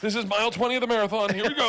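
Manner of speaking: high, loud, hurried marathon cheer; mock pep talk. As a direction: urge yourself on like a struggling runner. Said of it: "you just were like".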